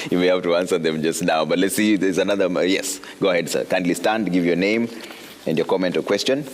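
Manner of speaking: ordinary talk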